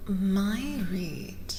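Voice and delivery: ordinary delivery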